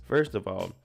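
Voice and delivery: ordinary talk, nothing marked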